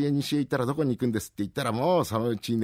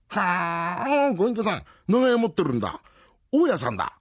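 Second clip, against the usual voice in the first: high eager voice; 9 semitones higher, 4 dB louder than usual. Acted. Rakugo, excitable Hachi talks.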